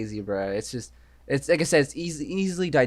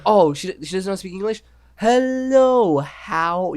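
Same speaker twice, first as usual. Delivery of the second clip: mocking condescension; 7 semitones higher, 5 dB louder, slower than usual. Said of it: "She's like".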